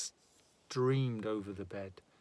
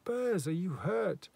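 anxious; 7 semitones higher, 4 dB louder than usual. Acted. Woman checks shot man.

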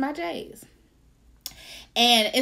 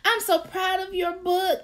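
put-on child niece voice; 9 semitones higher, slower than usual. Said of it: "she's like".

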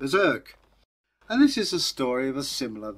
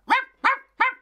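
very high yappy ruffs; squeaky comic barks; dog bark; 7 dB louder, faster than usual. Acted. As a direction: yap like a little dog. Dog barking in story.

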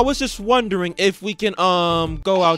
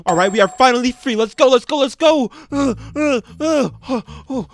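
excited escape; 5 semitones higher, 5 dB louder than usual. Pretend. Escaping over the fence, exhausted.

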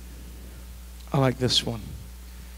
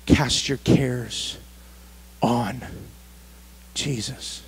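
soft plea; 5 semitones lower, 8 dB quieter, much slower than usual. Real, not acted. Slow soft altar-call plea.

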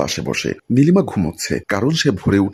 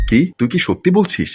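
sharp, higher, indignant question; angry disbelief; 7 semitones higher than usual. Acted. Wife hearing of her husband's chat, angry. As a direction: demand the truth, outraged. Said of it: "রেগে গিয়ে বলল".

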